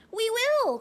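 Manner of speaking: tiny mice promising at once; high loud mouse chorus; shrill chorus. Acted. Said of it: "all the mice in a shrill chorus".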